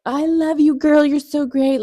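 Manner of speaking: high, slow, gushing praise; gushing fan praise. Retold or imitated. Fans gushing over Amber in the interview. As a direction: gush like an adoring fan. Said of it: "just like".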